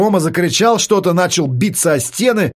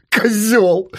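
angry insult; 6 semitones higher than usual. Acted. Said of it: "высказался он по адресу психолога".

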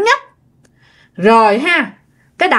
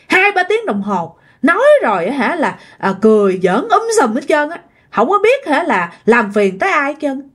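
irritated telling-off in a raised pitch; retold angry scolding; 4 semitones higher than usual. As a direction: imitate a girl telling someone off. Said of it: "có giọng của một người con gái mới, mới la vậy nè".

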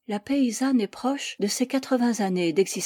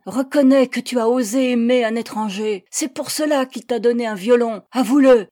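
loud, raised accusing shout; hateful yell; 3 semitones higher, 8 dB louder than usual. A narrator's reading. Young persecutor yells at the woman in public, hateful shout. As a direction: hurl the accusation with hatred. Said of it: "L'un d'eux me hurla, avec un visage déformé par la haine".

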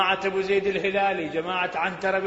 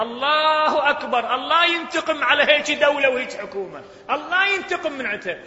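higher, louder exclamation of anger; outraged outcry; 7 semitones higher, 4 dB louder than usual. Real, not acted. Preacher cursing the government, loud angry outcry. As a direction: cry out against the government.

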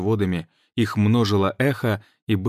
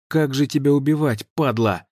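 angry; 6 semitones higher than usual. Acted. Cursing the golem.

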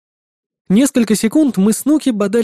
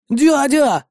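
high-pitched mock child's call; 7 semitones higher than usual. A narrator's reading. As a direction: call out like an eager nephew. Племянник зовёт дядю. (звонкий детский оклик)

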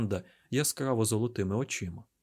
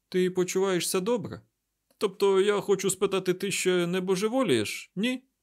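high, uneasy questioning; nervous concern; 8 semitones higher, 4 dB louder than usual. A narrator's reading. Mat worries Rand is going mad, nervous. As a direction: ask it high, ending on a raised little check. Mat anxiously checks Rand's sanity.

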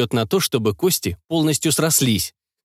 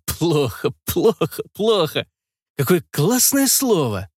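giggly drugged repeat; 4 semitones higher, slower than usual. A narrator's reading. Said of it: "я начал смеяться".

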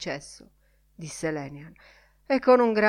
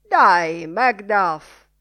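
loud, punched exhortation; rallying call; 11 dB louder, much slower than usual. A narrator's reading. Man with umbrella urges his companion on, rallying call. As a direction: urge him on loudly.

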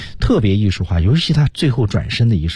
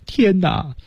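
slow, higher mock "oh heavens"; appeal to heaven; 11 semitones higher than usual. Teacher describing hands pointed at the sky, slow acted appeal to heaven. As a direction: cry to the sky, acted and drawn out. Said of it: "手指向天空，觉得".

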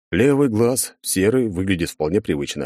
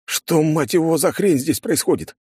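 higher, outraged swearing; 9 semitones higher than usual. Acted. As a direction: demand it, outraged and high. Outraged at his body. (возмущённо, на повышенных тонах)

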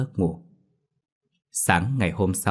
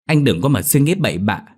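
a touch of indignant pushback, higher; protesting retort; 5 semitones higher, 4 dB louder than usual. A narrator's reading. Younger brother protests to his teasing big brother, mildly indignant. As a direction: protest quickly, somewhat stung. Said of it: "Nó cãi".

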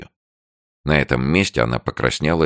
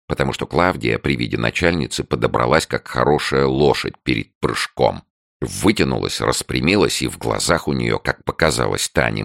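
ordinary delivery

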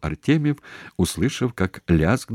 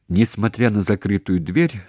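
plain reading voice

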